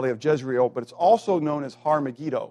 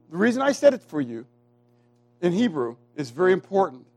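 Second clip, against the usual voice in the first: ordinary talk, nothing marked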